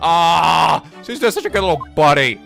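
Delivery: ordinary talk, nothing marked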